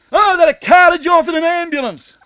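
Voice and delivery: yelled high and loud, mocking the kid; hammed-up teen yelling threats; furious shout. Retelling the angry boy shouting at Barry.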